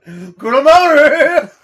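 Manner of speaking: loud, high, drawn-out holler; mock angry yell. Parent wants crying son removed, hammed-up bellow. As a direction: bellow the order across the house.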